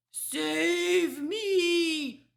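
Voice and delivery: high, loud, long cry for help; terrified scream. A narrator's reading. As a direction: scream for your life. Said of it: "screamed the flesh lump eater".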